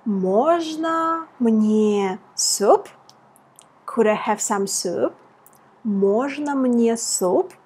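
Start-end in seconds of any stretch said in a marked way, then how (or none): 0.0-2.9 very slow model phrase. Shown as a demonstration. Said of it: "The first phrase was".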